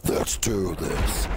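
deep, slow, menacing demon growl; demonic voice. Pretend. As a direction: drag it out like a slightly demonic beast. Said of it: "Hope you enjoyed the slightly demonic voice there".

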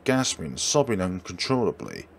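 ordinary talk

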